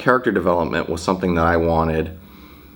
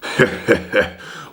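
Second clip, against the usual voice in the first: breathy laugh. Real, not acted. Laugh before Merchant impression.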